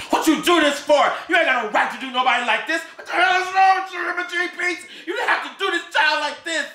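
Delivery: screamed high and loud; outraged yelling; furious shout. Acted. Protecting the child, screaming at Emma Jean.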